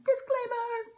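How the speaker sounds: mock announcement. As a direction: sing it out like a herald. Announcing a warning mid-talk.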